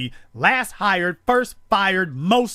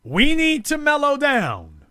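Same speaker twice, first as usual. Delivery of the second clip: mock disbelief; 8 semitones higher than usual. Real, not acted. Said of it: "Are you serious?".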